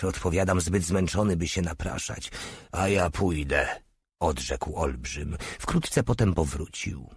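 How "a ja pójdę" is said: slow, lightly voiced big man; a hint of the giant, unhurried; giant's line. A narrator's reading. Giant Mały offers to go. (powoli, lekko zagrany olbrzym)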